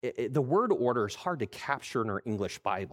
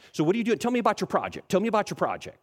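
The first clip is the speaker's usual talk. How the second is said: higher, louder, pushy helpful salesman; eager store clerk; 9 semitones higher, 5 dB louder, faster than usual. Retold or imitated. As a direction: pounce on the customer with help. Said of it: "that really zealous, uh, worker".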